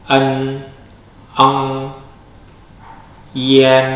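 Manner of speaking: en, eng, yen each said slowly and clearly, the final nasal held; Mandarin finals demo. Shown as a demonstration. Teacher models Mandarin finals for the class, carefully. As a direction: sound out each Pinyin final on its own. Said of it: "I A N bacanya yen".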